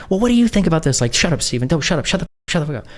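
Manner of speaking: high, frantic mock of an interrupting caller; agitated outburst. Retelling how she turned to the third party, high agitated imitation.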